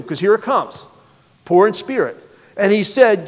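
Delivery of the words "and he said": normal talking voice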